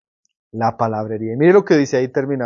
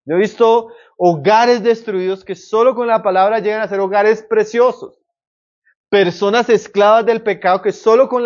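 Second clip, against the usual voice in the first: raised, fervent preacher voice, higher and louder; preaching; 7 semitones higher, 4 dB louder than usual. Acted. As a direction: preach it out to the congregation with conviction. Preacher building up the sermon.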